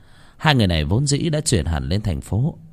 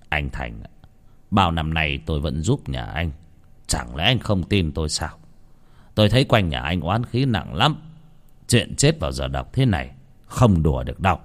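shaman pleading with Thành; weary old shaman; 3 semitones lower than usual. Acted.

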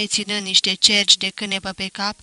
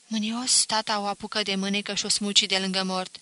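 plain reading voice

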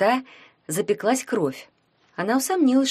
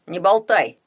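short, louder cry of protest; shouted; 6 dB louder than usual. A narrator's reading. Vanka tells his gloating rival to stop talking, shouting. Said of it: "крикнул Ванька".

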